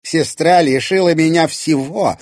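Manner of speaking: high, loud bitter accusation; spiteful. A narrator's reading. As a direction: spit out the old grudge. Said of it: "злобно произнесла Ирина".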